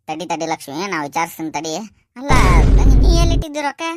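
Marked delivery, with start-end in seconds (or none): 2.2-3.8 higher, louder and fast, pressing the daughter; loud urgent question. Acted. Father urgently asking daughter where she kept the money, loud. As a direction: demand it quickly.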